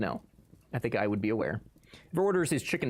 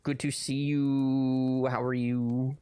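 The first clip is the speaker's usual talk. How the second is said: slow greeting; much slower than usual. Real, not acted. Greeting chatters.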